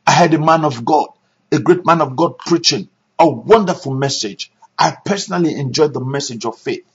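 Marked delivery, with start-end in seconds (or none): none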